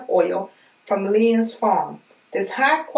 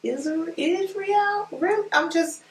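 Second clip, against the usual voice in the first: amazed disbelief; 6 semitones higher than usual. Real, not acted. Amazed it's from Israel.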